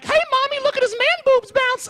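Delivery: put-on very high squealing child voice; hammed-up high kid impression; little kid. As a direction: call out to mommy like an excited child. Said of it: "Little kids are tapping on the glass like".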